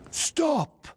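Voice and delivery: sudden sharp shout to halt someone. Acted. Man possessed by Usha calling out to halt the detective, shouting. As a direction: yell it out to stop him cold.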